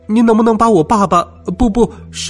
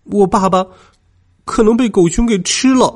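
put-on high, anxious child on the edge of crying; distraught, pitched well up; halting, tearful worry; near tears; 6 semitones higher than usual. A narrator's reading. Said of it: "我急得快哭出来了".

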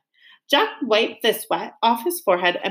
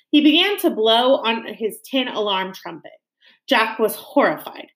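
ordinary delivery

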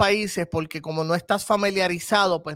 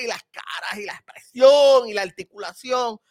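teatral exagerado; 2 semitones higher, 5 dB louder than usual. Shown as a demonstration. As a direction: stretch the key words, theatrical and big. Muestra la grandilocuencia del teatro. (teatral, agrandando las palabras)

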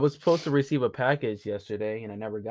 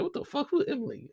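higher, soft confused "who Emily"; puzzled retort; 10 semitones higher, 6 dB quieter than usual. Retold or imitated. Retelling hearing a neighbor yell, baffled and higher. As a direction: sound baffled at the strange name. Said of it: "I'm like".

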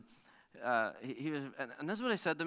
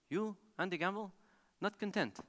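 higher, halting mock French accent; 6 semitones higher than usual. French champion sizing up an amateur driver, mock French accent. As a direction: pronounce the verdict in an imitated French accent. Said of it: "in his French accent".

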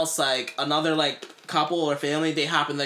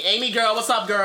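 high, loud cheery call; calling out to Amy; 6 semitones higher, 4 dB louder than usual. Retold or imitated. Greeting her friend Amy with a loud cheery call. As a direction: hail a friend across the street.

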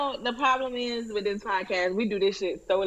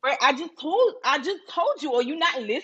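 higher-pitched, indignant put-on New York accent; 6 semitones higher than usual. Alter ego telling co-hosts to listen, New York accent. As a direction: scold them in a hammed-up New York accent. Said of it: "Don't do that accent".

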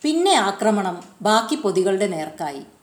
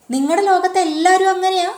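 much higher, louder astonished question; amazed question; 10 semitones higher, 5 dB louder, faster than usual. A narrator's reading. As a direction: gasp out the question in wonder. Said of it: "Deepu അത്ഭുതപ്പെട്ടു". (അത്ഭുതത്തോടെ ഉയർന്ന ചോദ്യം)

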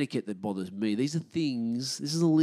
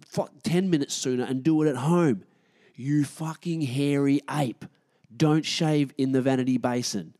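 louder, higher, slow insulting tirade; furious rant; 4 semitones higher, 6 dB louder than usual. Real, not acted. Gym-goer berating a man shaving in the sink, furious. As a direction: tear into the hairy ape.

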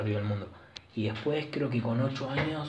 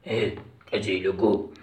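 punchy comedian impression, louder at the end; Joey Díaz impression; 5 dB louder, slower than usual. Showing how the Cuban comedian talks, imitated voice. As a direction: imitate a fat Cuban comic's greeting. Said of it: "el tono de voz es".

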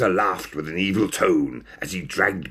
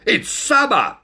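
high snap; 14 semitones higher, 4 dB louder than usual. Acted. Ringmaster snaps objection.

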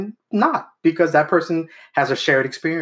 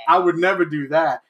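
laughing mock denial; 3 semitones higher than usual. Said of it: "I'm making fun of you doing that".

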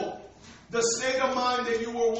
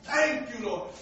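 usual speaking voice

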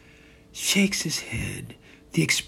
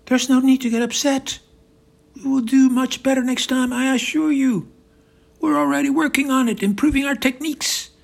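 high, insistent reassurance; Putin, placating; 11 semitones higher, 5 dB louder than usual. Acted. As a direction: placate him in a high, loud voice.

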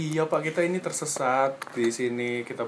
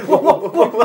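laughing; 9 semitones higher, 9 dB louder than usual. Acted. Mocking repeat with laughter.

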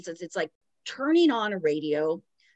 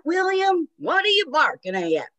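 exasperated yell; 10 semitones higher, 6 dB louder than usual. Retold or imitated. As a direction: holler at the dog in frustration. Impersonating Travis yelling at dog.

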